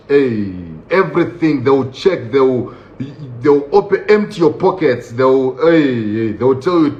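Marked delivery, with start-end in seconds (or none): none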